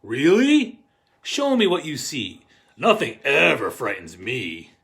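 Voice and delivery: put-on loud, lively read-aloud to kids; a parent tucking a child in, read out loud and animated; storybook reading. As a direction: read it big for the children in the back row. Parent role in the picture book.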